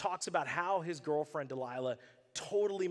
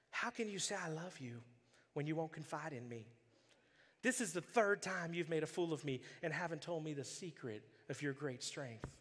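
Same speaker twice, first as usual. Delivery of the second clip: cheesy Southern Belle; 7 dB quieter than usual. Shown as a demonstration. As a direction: read it like a syrupy Southern Belle. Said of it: "this is my best Southern Belle cheesy voice".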